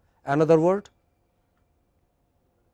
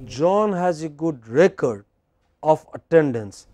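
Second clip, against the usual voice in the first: unhurried model with a clear gap before "of"; pause before "of". Shown as a demonstration. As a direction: break after "record", then "of attendance". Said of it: "you have to have a little pause".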